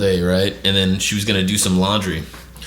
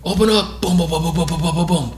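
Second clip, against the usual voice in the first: higher-pitched mock shout, then rapid banging noises; firemen at the door; 9 semitones higher than usual. Firefighters knocking at the door, urgent imitated call. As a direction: imitate a demand and urgent pounding.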